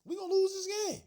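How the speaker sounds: high, loud panicked repeat; a fan's squeaky prediction of doom; panicked squeal. Retold or imitated. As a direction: jump way up in mock panic. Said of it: "I'm like".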